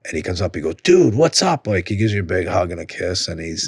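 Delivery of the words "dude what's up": high, exuberant retold hello; excited greeting. Comedian greeting a friend he hasn't seen in months, excited. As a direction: greet a buddy with a big high-pitched burst.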